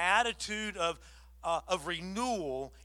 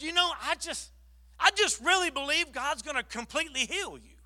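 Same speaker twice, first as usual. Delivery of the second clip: much higher, earnest believing voice; fervent encouragement; 8 semitones higher than usual. Retold or imitated.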